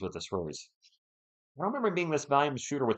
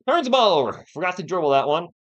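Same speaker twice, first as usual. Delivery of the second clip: a sudden louder, higher cry at the turnover; exasperated outburst; 4 semitones higher, 7 dB louder than usual. Real, not acted. Fan watching his team turn it over, loud groaning outburst. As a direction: blurt it out in dismay.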